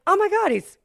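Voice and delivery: high, loud shocked exclaim; shocked gasp. Retold or imitated. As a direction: realise with a jolt. Shocked at discovery.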